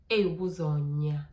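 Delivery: low, slow, loud menacing call; retold angry threat. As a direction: imitate an angry man's drawn-out warning. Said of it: "and he was so angry".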